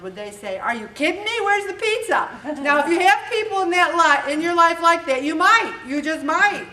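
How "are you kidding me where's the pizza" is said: higher, louder, incredulous; mock outrage. Dinner guest scoffing at the salmon, mock outrage. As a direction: play the shocked guest asking for pizza. Imitating guests demanding pizza.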